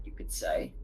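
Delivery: a sly whispered aside. Acted. AI character muttering a sly aside to the party, in a whisper. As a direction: drop to a whisper for the quip.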